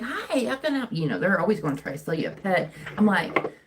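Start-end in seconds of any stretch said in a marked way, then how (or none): 0.0-1.0 high-pitched customer-service greeting; put-on higher voice of a saleswoman; imitating store saleslady. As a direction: mimic an eager shop greeter. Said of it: "and the lady's like".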